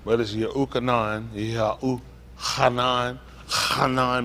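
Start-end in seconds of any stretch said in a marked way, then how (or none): 1.3-4.2 drawn-out Yehuchanan, Hanan with a heavy throaty H; enunciated double H. Shown as a demonstration. Teacher showing listeners the double H, carefully enunciated. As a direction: stretch the H of Hanan into a throat scrape. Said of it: "we talked about the double H".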